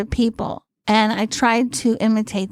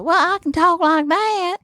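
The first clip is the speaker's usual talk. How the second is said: higher-pitched sample character voice; put-on character voice; 8 semitones higher than usual. Comic showing the producer her range, higher put-on voice. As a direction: demonstrate a put-on voice in an eager pitch. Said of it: "And I said".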